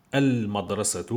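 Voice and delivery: "Al-Madrasatu" with a clear held L; al-madrasatu, L fully heard; L pronounced. Shown as a demonstration. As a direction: sound the L in "Al-Madrasatu". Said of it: "le huruf al-qamariya".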